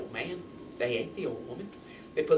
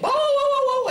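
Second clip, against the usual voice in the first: loud, high-pitched dog barking; 12 dB louder than usual. Acted. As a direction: bark out like an alarmed dog.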